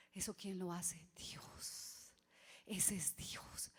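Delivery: hushed, slow whisper of awe; awed whisper. Real, not acted. Preacher whispering in awe to the congregation. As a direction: whisper it like a secret wonder. Awed climax of miracle story.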